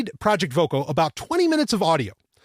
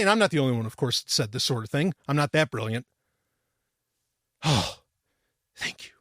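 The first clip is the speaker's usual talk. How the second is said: ordinary delivery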